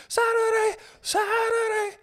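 high-pitched chanted announcer yell; hype event announcer. Acted. Imagining knights jousting on horseback, hype announcer shout. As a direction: holler it like a monster-rally promo. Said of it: "he's on a horse and he's like".

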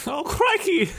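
very high Australian squeal; mock Australian. Mocking a game heroine, high mock Australian. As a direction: echo the joke in a silly Aussie accent. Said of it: "She's not fucking Australian".

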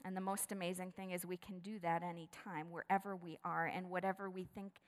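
soft, hushed pastoral voice; quiet closing prayer invitation; prayer lead-in. Real, not acted. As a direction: invite them gently toward prayer. Leads into prayer.

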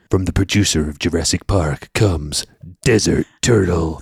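deep, dramatic trailer narrator; movie trailer voice. Pretend. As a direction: boom it out like a blockbuster trailer. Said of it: "can I do my movie trailer voice".

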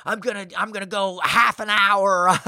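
hammed-up rage while training hard; going nuts. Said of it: "I'm going nuts".

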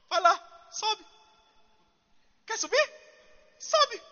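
high, mocking sing-song dare; sarcastic. Preacher mimics the prophet's sarcastic dare to the king. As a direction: tease him to go up, dripping sarcasm. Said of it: "Ele disse".

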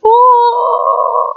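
long high held "ooh"; high, sustained mock-timid "ooh"; mock-meek "ooh". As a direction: coo a drawn-out high "ooh", sending up weakness. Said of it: "meek is not weakness".

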